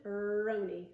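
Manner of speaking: "roni" starting on a long, held R; R sound demo. Shown as a demonstration. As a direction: squeeze the R that opens "roni". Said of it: "put your tongue in the R position".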